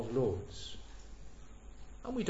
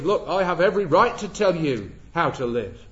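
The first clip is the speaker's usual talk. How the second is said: pushy overbearing claim; 8 dB louder than usual. Retold or imitated. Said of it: "we do not say to a work colleague".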